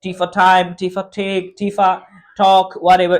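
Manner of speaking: "time" and "take" with a breathy aspirated ʈʰ; aspirated English t. Shown as a demonstration. Teacher showing Pakistanis the native T sound. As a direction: puff air after each T. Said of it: "वो टी होती है".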